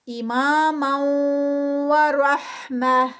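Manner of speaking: رحمہ with the ta turned into ha; stopping on ha. Shown as a demonstration. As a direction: chant it very slowly and stop at the end. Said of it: "ہا میں convert ہوگی".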